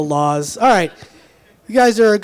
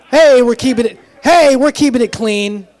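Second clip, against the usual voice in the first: playful scolding; 6 semitones higher, 6 dB louder than usual. Real, not acted. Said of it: "scolding the heckling crowd".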